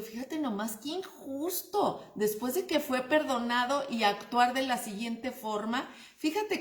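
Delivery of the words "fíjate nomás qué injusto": indignant aside. Retold or imitated.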